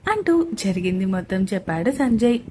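plain reading voice

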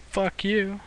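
high sharp fuck you; indignant fuck you at cops; angry outburst. Real, not acted. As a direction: spit it out at the police.

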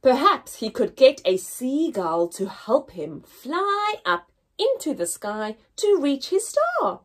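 plain reading voice

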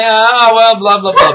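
high, loud blah-blah mimicry; mock objection. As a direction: imitate a complainer droning on. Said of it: "people are gonna say".

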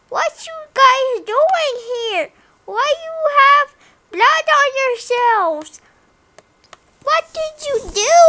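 high, questioning demand; acted confrontation. Blue finding blood on them, acted alarmed questioning. Other penguin confronts the speaker.

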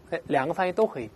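usual speaking voice

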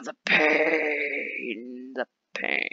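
hammed-up, drawn-out groan of pain; mock agony. Mock agony at spending money.

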